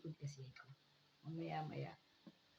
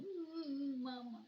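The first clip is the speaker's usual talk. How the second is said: high, crying "mama"; an old father missing his wife, near tears; tearful father; 11 semitones higher, 3 dB louder, much slower than usual. Retold or imitated. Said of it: "sabi ni tatay". (umiiyak na tatay, mataas ang boses)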